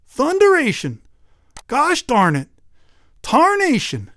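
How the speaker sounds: loud, high-pitched cursing; a crusty old man cussing out loud; old-timer swearing. Acted. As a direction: bellow the oaths like a frustrated farmer. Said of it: "He heard someone swearing".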